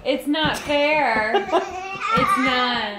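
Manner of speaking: laughing, high and slow. Real, not acted. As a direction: laugh through mock sympathy. Laughing sympathy with toddler.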